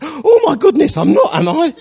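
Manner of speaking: high, loud startled cry, hammed up; mock shock. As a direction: act out her horrified discovery. Retells lady's shock at her seat.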